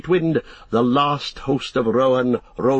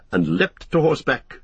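plain reading voice

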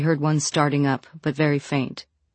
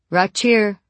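calling back, louder; 5 semitones higher, 5 dB louder than usual. A narrator's reading. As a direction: call down to him. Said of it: "she called back".

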